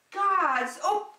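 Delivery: ordinary delivery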